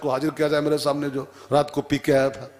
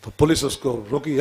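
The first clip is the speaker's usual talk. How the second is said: normal talking voice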